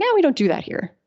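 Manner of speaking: brisk, unbothered shutdown; a casual, firm no; matter of fact. Shown as a demonstration. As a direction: shut it down calmly. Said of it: "You could just be super matter of fact".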